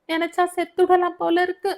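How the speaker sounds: high-pitched cry of despair; anguished, high-pitched outburst. Acted. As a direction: say it at the end of your endurance. Friend in despair on phone.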